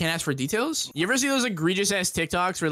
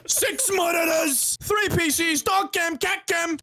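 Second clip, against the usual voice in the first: hype setup brag; 11 semitones higher, slower than usual. Retold or imitated. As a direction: boast about the setup like a streamer. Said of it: "the alcoholic version of X U C explaining his setup".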